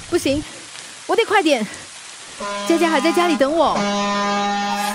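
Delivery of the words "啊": sudden low sharp cry of alarm; startled cry. Acted. Grandma dropping a bowl, a startled cry. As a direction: yelp as the bowl slips and smashes.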